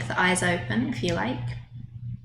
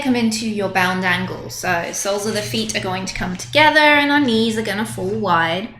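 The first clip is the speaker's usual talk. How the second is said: normal talking voice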